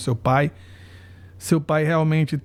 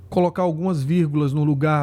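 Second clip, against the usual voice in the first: ordinary talk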